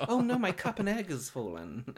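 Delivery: high, hammed-up fake panic; mock dismay. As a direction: play it like a staged accident. Acting fake accident for prank.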